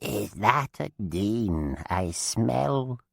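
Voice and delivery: quiver with pain, barely alive; whimpering dead witch. A narrator's reading. Chained dead witch sniffs out a Dean, whimpering. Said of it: "she whimpered, her voice quivering with pain".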